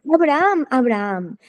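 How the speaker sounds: a high first call, then dropping. A narrator's reading. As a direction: lightly imitate a voice calling from above. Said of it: "le dio a voces desde el cielo".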